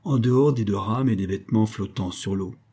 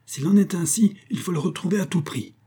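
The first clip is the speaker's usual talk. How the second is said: much higher, forceful declaration; proclaiming; 9 semitones higher than usual. A narrator's reading. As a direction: declare it must be found at any cost. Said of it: "clama-t-elle".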